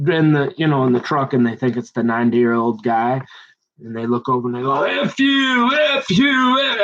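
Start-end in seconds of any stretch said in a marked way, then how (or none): 4.8-6.8 high, far-off yelled curses at a slow driver; mock angry yelling. Passing drivers cursing at the slow truck, mock angry yelling.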